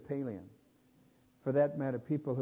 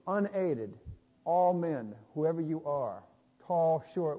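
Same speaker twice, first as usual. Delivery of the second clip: full-force preaching; 3 semitones higher, 4 dB louder, slower than usual. Acted. Preacher to congregation, declaring that no one reaches God, full-force preaching.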